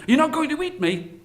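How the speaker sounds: high anxious question; nervous Brahmin. Acted. Brahmin at the cage asking again, nervous. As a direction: check again, high-voiced and worried.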